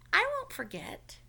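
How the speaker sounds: put-on high, slow child's promise; a little turtle promising earnestly; Franklin's promise.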